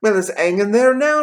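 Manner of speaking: high, loud lad's reply; eager boy. A narrator's reading. Boy replying to Scrooge, high and eager. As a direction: pipe up with the news. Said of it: "replied the boy".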